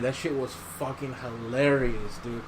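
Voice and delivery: normal talking voice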